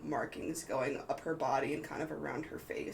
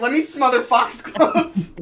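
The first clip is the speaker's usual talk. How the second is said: loud mock outburst, higher and laughing; abrupt outburst, imitated; 9 semitones higher, 17 dB louder than usual. Game master quoting the player's abrupt threat, laughing loud imitation. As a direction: mimic the blurt, slow and loud through laughter. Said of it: "Just the abrupt".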